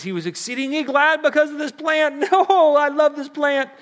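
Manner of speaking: Jonah voiced high and loud, gushing over his plant; a giddy Jonah, delighted with his shade; Jonah's glee. Preacher voicing Jonah delighting in his plant, high giddy gush.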